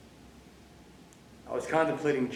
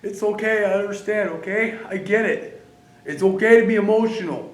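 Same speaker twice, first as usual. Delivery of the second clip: higher, louder, comforting; loud reassurance; 8 semitones higher, 9 dB louder than usual. Real, not acted. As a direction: reassure him firmly. Reassuring Oscar.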